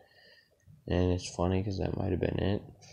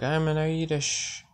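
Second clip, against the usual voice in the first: higher put-on voice of the boastful drunk; Irish bar guy; 7 semitones higher than usual. Imitating the Irish guy declaring himself, a higher, put-on boast. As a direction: announce it proudly like a tipsy stranger. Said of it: "He's like".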